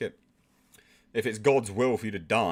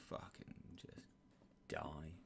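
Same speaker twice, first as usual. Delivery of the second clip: hushed mock whisper; muttered aside; 6 semitones lower, 22 dB quieter, slower than usual. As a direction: breathe it out under your breath. Mocking the dying character.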